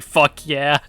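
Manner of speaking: a gruff little cheer of approval. Retold or imitated. Viewer cheering the task force guy on, gruff cheer. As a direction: pump your fist and mean it. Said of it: "and I'm like".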